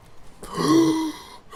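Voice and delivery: big high offended gasp; shocked gasp. Retold or imitated. Girl hearing the insult, sharp shocked gasp. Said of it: "She went".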